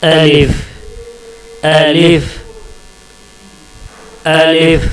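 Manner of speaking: अलिफ with an open a and a clear final f; Arabic letter alif. Shown as a demonstration. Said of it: "इसको इस तरह से pronounce करेंगे". (अरबी अक्षर अलिफ का साफ़ उच्चारण)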